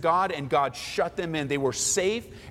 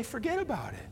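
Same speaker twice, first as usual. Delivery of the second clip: giving up; 6 semitones higher, 7 dB quieter than usual. Retold or imitated. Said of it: "some of you just let go and say".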